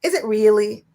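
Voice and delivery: is it really, playfully sceptical; doubting teen. Retold or imitated. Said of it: "was kinda like".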